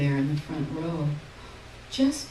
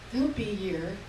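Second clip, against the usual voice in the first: ordinary delivery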